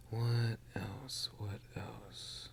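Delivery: musing whisper to himself. Real, not acted. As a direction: whisper the question, thinking.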